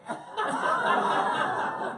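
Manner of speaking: rising airy laugh, high and loud. Real, not acted. After asking if they tasted the toilet water, loud laugh. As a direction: burst out laughing at the joke.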